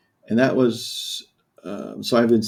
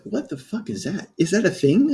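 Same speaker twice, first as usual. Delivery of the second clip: a higher, baffled what-the-hell; incredulous disbelief; 8 semitones higher than usual. Retold or imitated. Patient hearing chronic cancer from doctor, stunned disbelief. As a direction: throw the question back in stunned confusion. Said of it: "I was like".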